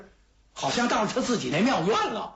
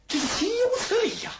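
much higher, indignant wail of outrage; outraged cry. Acted. King cries out in outrage at the prisoner, indignant wail. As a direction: let the outrage crack out.

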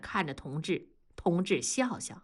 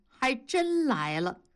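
ordinary delivery, nothing marked

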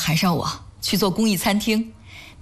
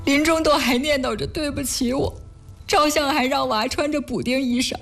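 higher-pitched, upset lament; tearful grief; 7 semitones higher than usual. Acted. Wife remembers her late mother to husband, tearfully. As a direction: speak through rising tears. Grieving over dead mother, being comforted.

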